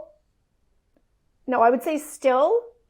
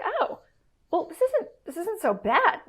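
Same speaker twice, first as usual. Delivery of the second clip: much higher, rising, delighted wonder; pleasantly surprised; 7 semitones higher than usual. Retold or imitated. Wife realising the marriage is improving, high and delighted. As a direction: sing it up in happy surprise.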